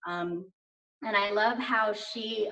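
usual speaking voice

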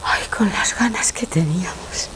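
breathy whispered sigh of feeling; soft, airy voice lifting with emotion; hushed emotion. Acted. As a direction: half-whisper it, overcome by the news. Learns of pregnancy, overcome.

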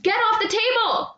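high loud command to get down; sharp yell. Acted. Owner scolding the cat on the table, sharp yell. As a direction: yell it to stop the cat cold.

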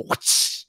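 voiceless whooshing crack; imitated belt snap. Showing how dad snapped the belt, imitated whoosh. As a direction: make the sound of a snapped belt. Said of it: "snap it in the middle and go".

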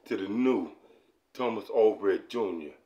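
low, slow, soft announcer voice; announcer intro. Acted. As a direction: drawl out the album intro like a radio host.

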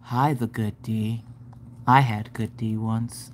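slow, low, soft drone; deadpan it with no life at all; monotone. Shown as a demonstration. Showing how nobody greets people, slow monotone. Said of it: "how monotone your ass is".

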